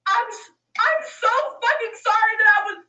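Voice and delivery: real upset cry of apology; real anguished outburst. Real, not acted. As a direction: blurt it out in anguish, close to crying. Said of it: "I'm not gonna cry on here".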